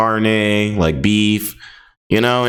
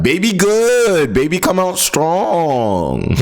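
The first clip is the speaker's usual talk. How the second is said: much higher, very slow hammed-up Southern drawl; exaggerated Southern voice; 8 semitones higher, much slower than usual. Streamer repeats the line for laughs, higher and slower mock Southern drawl. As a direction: ham it up even more, pitched way up and dragged out. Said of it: "I don't know why I said it like that".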